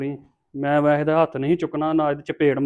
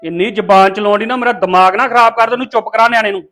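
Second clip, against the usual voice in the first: raised, louder scolding; angry warning; 5 semitones higher, 6 dB louder than usual. Acted. Man ordering mother to stop backtalk, angry. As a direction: warn her off angrily, voice up.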